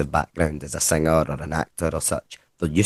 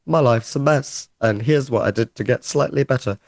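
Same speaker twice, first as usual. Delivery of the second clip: Simon Amstel impression; 7 semitones higher than usual. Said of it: "him on stage going".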